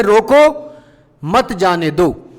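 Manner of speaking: रोको as a warning, pause, slow मत जाने दो; warning, pause after रोको. Shown as a demonstration. Teacher demonstrates the warning, pausing after रोको. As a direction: break after रोको, then stretch out मत जाने दो. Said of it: "एक चेतावनी हो गई है".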